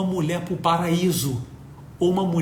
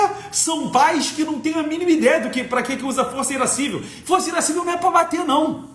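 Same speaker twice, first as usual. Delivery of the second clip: emphatic rant; 8 semitones higher, faster than usual. Retold or imitated. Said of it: "Ele falou".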